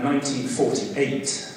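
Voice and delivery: usual speaking voice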